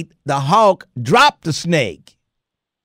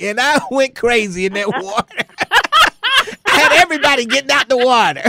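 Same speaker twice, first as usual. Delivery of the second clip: laughing through the story, pitched up; 9 semitones higher than usual. Real, not acted. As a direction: crack up at your own panic. Laughing retelling his panic.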